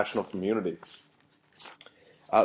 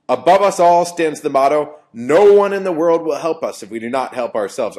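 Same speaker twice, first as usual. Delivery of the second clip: loud, declaiming speech-hall delivery; mock-yelling an old political speech; mock Hitler yell; 3 semitones higher, 7 dB louder than usual. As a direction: shout it like a rally orator. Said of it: "I'm trying to kind of yell because that's the way he talked".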